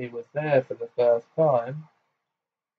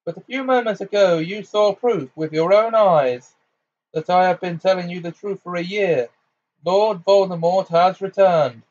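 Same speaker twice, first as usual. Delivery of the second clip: forceful; 6 semitones higher, 5 dB louder than usual. Acted. Dumbledore rebuking the minister.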